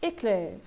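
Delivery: "Éclair" with open è in the last syllable; open E sound. Shown as a demonstration. Teacher reading French words for students, open E. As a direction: carefully model "Éclair", falling on open -clair. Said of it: "Aqui ele tem um som de E".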